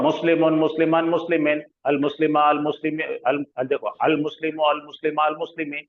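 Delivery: quick, easy Arabic drill without stopping; fast flowing recitation. Shown as a demonstration. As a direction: rattle off the forms smoothly. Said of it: "ऐसा, ऐसा, ऐसा पढ़ना". (फटाफट बहती हुई अदायगी)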